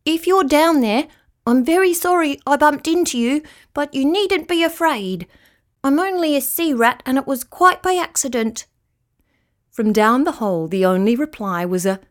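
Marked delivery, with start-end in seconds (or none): 0.0-8.7 higher-pitched apologetic call; slightly scared, reassuring call. A narrator's reading. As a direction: call gently down a hole to a stranger. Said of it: "went to the edge of the hole and called out".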